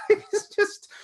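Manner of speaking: high, breaking up with laughter; words squeezed out through a laugh. Real, not acted. Laughing at the story.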